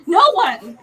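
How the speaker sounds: indignant, forceful "No one"; emphatic answer. Real, not acted. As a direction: punch out "No one" in exasperation. Answers own rant, then repeats lower.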